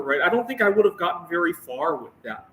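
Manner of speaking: ordinary delivery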